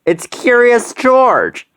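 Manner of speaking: put-on a light touch of young Betsy; Betsy voiced. A narrator's reading. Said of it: "said Betsy".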